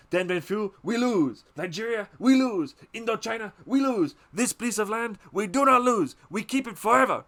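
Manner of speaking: high, loud, hammered "we lose" each time; defiant rant. Retold or imitated. The French father rants to Willard, shouting defiantly. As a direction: bellow each defeat like a wounded patriot.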